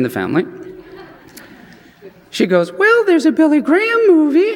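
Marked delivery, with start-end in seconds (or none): 2.7-4.6 much higher, hopeful mother's voice; mom's suggestion. Retold or imitated. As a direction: pitch it up like a mom offering an idea. Mom voiced in a story.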